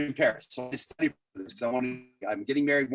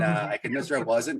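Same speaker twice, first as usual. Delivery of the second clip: ordinary delivery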